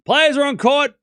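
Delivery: high, loud call-out; loud announcement. Pretend. Streamer telling chat players arrived, loud announcement. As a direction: announce it at full voice.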